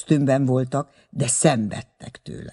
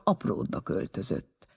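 usual speaking voice

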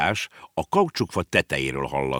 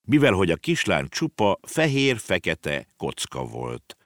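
plain reading voice

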